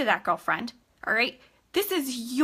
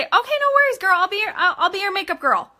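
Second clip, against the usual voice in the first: cheery high sales voice; bright high reply; 7 semitones higher, 4 dB louder than usual. Shown as a demonstration. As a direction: pitch up bright and friendly. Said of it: "and you say".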